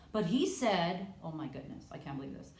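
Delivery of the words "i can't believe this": usual speaking voice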